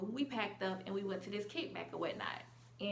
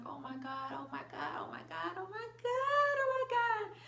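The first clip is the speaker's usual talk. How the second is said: whisper building to squeal; 11 semitones higher than usual. Retold or imitated. Reliving hugging the girl she loved, whisper to squeal. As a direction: start in a whisper, climb to a high excited squeal.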